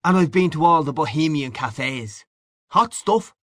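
high, swinging Irish bar-room voice; boisterous Irish man-about-town; hearty Irish old pal. A narrator's reading. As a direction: greet an old pal with breezy swagger. Same figure.

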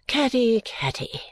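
high first name sinking to a whisper; weary. A narrator's reading. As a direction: sigh out the daughter's name wearily. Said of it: "quite weary of such little matters".

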